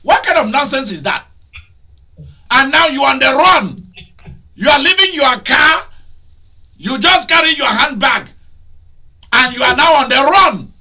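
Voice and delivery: high, loud, scolding sermon delivery; fiery preacher rebuking the congregation; preaching at full force. Acted. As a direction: thunder it at them from the pulpit.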